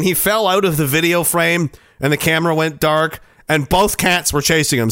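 usual speaking voice

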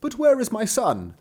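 a father wondering aloud; puzzled father. Acted.

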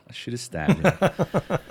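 laughing through the words; chuckling regret. Real, not acted. Repeat laughing.

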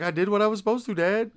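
high, loud imitation of a proud kid; proud little boy. Son defends himself to dad, proud kid voice. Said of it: "he's, like, all proud of himself".

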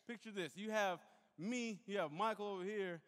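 usual speaking voice

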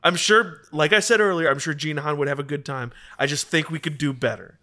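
normal talking voice

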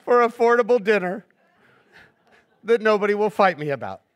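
hammed-up relieved mother thanking Costco; relieved tired parent. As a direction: gush thanks like a worn-out mom. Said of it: "a hot dog is like".

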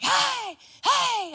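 high, loud hollering to call the cows; shouting for lost cows in the woods. Retold or imitated. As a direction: holler across the pasture to the cattle. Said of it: "I was still screaming and hollering".

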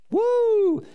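high, loud celebratory woo; joyful whoop. Real, not acted. Preacher to congregation after the story, loud joyful whoop. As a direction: whoop it out with joy.

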